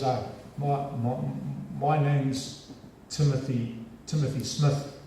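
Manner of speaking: mildly stammering, halting imitation; nervous student. Shaking student naming himself to the feared lecturer, nervously halting. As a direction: stumble slowly over your own name, lightly acting nerves. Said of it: "you could see his knees shaking".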